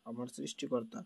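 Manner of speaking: normal talking voice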